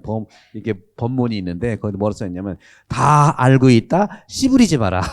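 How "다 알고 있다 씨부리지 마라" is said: slow, loud, gruff Gyeongsang delivery; Gyeongsang dialect. Retold or imitated. Priest reading the temple motto aloud in slow, loud Gyeongsang dialect. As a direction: drag it out loud like a blunt Gyeongsang elder.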